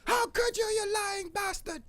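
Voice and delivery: shrieking ultra-high cartoon wail, slow at the end; betrayed anime heroine. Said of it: "I'm like".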